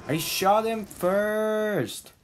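slow, drawn-out, high indignant protest; raging gamer. Real, not acted. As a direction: stretch it out in disbelief. Frustrated gamer insisting.

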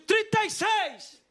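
high, slow auction yell; acted all-out bid shout; drawn-out winning bid. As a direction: holler it out, refusing to lose. Shouted final bid, later subdued.

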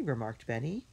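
plain reading voice

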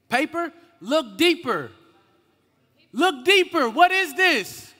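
loud, high teacher's challenge; insistent prompting. Real, not acted. Preacher quizzing listeners about the paper, insistent prompting. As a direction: push the crowd hard for an answer. Pressing the audience for answers.

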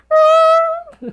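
very high, loud mock yelp; imitating a startled scream in the background; imitated scream. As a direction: squeal like someone spooked. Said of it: "someone in the back going".

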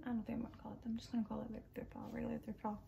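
breathy whispered aside; whispering. Real, not acted. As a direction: whisper it to yourself. Muttering aside about video title.

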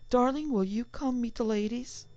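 high, sweet calling voice; sweet wife's call. Acted. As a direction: call sweetly across the house. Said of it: "his wife's clear, sweet voice".

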